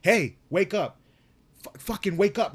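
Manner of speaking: high, loud, frantic retold yell; re-enacted panic, pitched well up; scared urgent shouting. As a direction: shout to rouse someone who won't wake. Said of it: "I was just scared".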